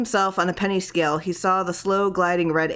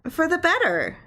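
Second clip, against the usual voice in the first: lightly voiced wife, much higher; a hint of a sweet wife; wife's voice; 11 semitones higher than usual. A narrator's reading. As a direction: Clarice answering her husband, higher.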